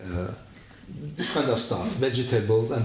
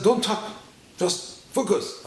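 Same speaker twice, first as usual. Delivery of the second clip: high, insistent hush; a hint of a bossy guide; hushing friend; 10 semitones higher than usual. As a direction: quote the friend telling him to shut up and focus. Friend hushing him mid-trip.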